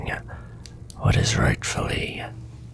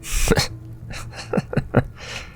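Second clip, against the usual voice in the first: soft, low-key villainous chuckle; breathy run of chuckles; amused chuckle; 4 dB quieter than usual. Acted. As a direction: chuckle quietly to yourself, amused.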